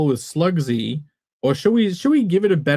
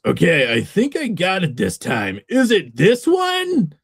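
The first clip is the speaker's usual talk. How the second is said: put-on voice that climbs at the question; character voice; 3 semitones higher than usual. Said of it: "I gotta do his voice".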